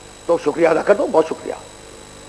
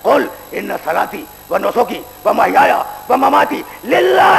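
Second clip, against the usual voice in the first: full-force preaching; 6 semitones higher, slower than usual. Acted. Preacher warns the young men about judgement day, in full-force preaching. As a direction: thunder it from the pulpit.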